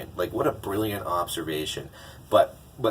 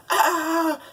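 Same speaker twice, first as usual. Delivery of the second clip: high, loud cartoon shriek of panic; imitated scream; 5 dB louder than usual. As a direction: scream like someone realizing they're late. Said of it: "looks down at the monkey and screams".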